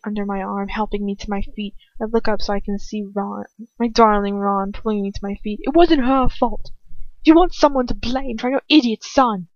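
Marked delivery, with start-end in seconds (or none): 5.6-9.5 defiant anger. A narrator's reading. Ron defending her, blaming Lucius.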